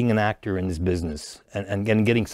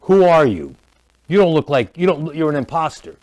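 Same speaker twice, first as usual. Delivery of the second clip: higher and louder accusing bodyguard; delivered line; 5 semitones higher, 5 dB louder than usual. Retold or imitated. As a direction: confront the imposter with a raised voice. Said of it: "and I delivered".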